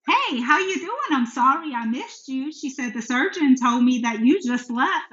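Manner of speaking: high, lilting chirpy greeting; giddy. Retold or imitated. Oncologist phoning her patient after a missed visit, giddy. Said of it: "she's all giddy".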